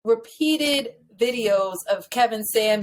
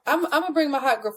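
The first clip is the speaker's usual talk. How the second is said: ordinary talk, nothing marked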